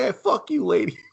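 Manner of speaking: high, soft mock snarl; a mock jab at the lady; mock comeback. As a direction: toss it off in a high put-on voice. Mocking Bruce's reply.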